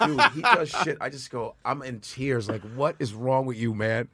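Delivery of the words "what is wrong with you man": ordinary delivery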